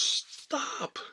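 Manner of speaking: high imitated teacher's shout; big mock-teacher "stop"; acted command to stop. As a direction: halt the whole room with one high yell. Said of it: "puts his hands up and goes".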